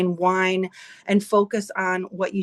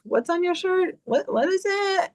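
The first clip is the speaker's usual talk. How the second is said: much higher, mimicking curious people; puzzled strangers quizzing her, pitched up; 9 semitones higher than usual. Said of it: "They're like".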